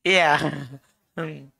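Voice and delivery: normal talking voice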